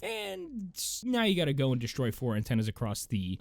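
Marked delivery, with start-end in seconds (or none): none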